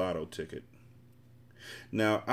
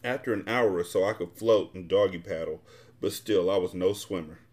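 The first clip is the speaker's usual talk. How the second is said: usual speaking voice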